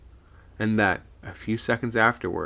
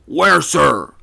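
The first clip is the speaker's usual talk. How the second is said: eager raised question, loud; 6 semitones higher, 11 dB louder than usual. A narrator's reading. Second mate answers the skipper from the mast, eagerly. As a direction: call back keenly, pitched up. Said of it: "asked the second eagerly".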